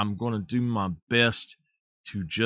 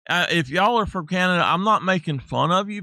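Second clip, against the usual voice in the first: ordinary delivery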